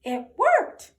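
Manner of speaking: high, loud squeaky joy; officer celebrating the win, squeaky; squeaky cheer. Acted. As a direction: cheer it like a tiny proud mouse.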